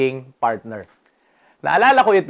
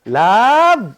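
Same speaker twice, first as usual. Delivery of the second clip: higher, louder call for the towel; wife calling out; 8 semitones higher, 5 dB louder, much slower than usual. Retold or imitated. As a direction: sing it out from the shower, wanting the towel. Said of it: "Sabi niya".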